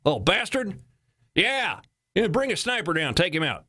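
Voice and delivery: high, hammed-up Texas caller; Texas accent. Texas caller reporting a boy with a grenade, mock Texas accent. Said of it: "This is Texas accent, by the way".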